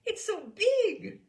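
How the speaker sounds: high, slow, loud marvelling; cooing woman. Acted. Fragrant woman marvelling at the bass, high and slow. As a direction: gush at the huge instrument.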